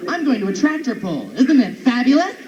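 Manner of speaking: lilting, mock-flamboyant chat. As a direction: gush it in a put-on camp voice. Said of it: "Everybody should talk like this".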